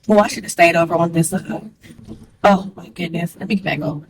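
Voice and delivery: normal talking voice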